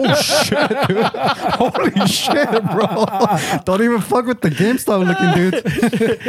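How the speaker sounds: high, laughing oh shit; cracking up in amazed disbelief. Retold or imitated. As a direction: gasp it out through laughter at the shock. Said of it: "And I'm like".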